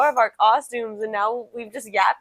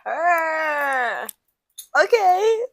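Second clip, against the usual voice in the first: high, loud, drawn-out purr then okay; sassy retold reaction; 8 semitones higher, 5 dB louder, much slower than usual. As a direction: act out your over-the-top approving reaction. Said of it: "And I was like".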